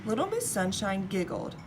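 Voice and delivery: usual speaking voice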